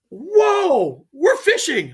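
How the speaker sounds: high, loud incredulous whoa, then we're fishing; retold protest to a partner who won't drop the subject; startled whoa. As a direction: throw up your hands in disbelief at him.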